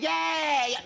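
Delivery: cheering liver. Pretend. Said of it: "your liver's gonna be going".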